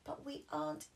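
high whiny protest; high childish protest; protesting Peppa. Acted. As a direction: insist you are not sleepy. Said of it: "said Peppa".

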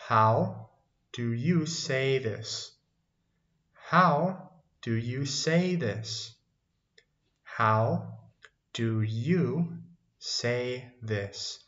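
slow, pause after HOW; slow teaching model. Shown as a demonstration. Teacher models an English question for learners, slow and spaced out. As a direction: give learners each word separately.

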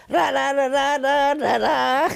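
mock trumpet growl, sung out; growled trumpet riff. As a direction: hum the growling trumpet part. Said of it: "I had to go".

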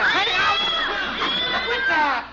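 sudden high, loud yelp at the cat; startled shout. Acted. Dad on ladder shouting as the cat jumps at him.

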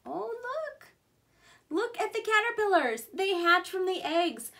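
high, loud delighted "oh look"; thrilled teacher pointing out caterpillars; excited discovery. Acted. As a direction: gasp with delight for the kids. Teacher delighted over caterpillar photos.

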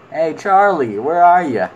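loud call to a hidden creature. Real, not acted. Player calling loudly to the creature.

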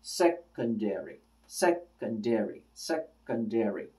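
"secondary" slow and soft, broken after "sec"; mapping out syllables. Shown as a demonstration. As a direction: softly measure out "secondary" beat by beat. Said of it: "from left to right".

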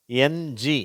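ordinary talk, nothing marked

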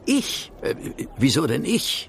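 a sudden high yelp of surprise on ich, then stammering; startled surprise. Acted. Watson, told he must take the case, in startled surprise. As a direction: caught off guard, point at yourself in disbelief.

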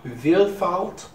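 slow, halting German read; sounding out German words syllable by syllable; attempted German. Shown as a demonstration. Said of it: "How was I?".

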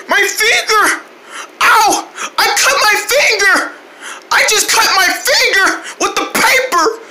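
high wailing cry; hammed-up crying. Puppet cries over paper cut.

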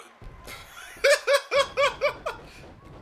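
real laughter, high and loud. Real, not acted. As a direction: burst out in a loud, high cackle. Laughing at teammate.

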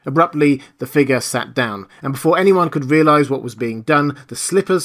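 usual speaking voice

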